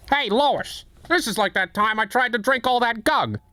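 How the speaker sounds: a lightly voiced Peter Griffin; Peter Griffin impression. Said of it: "I can't do any of those".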